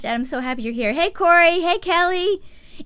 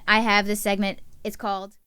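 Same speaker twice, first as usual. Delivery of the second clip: usual speaking voice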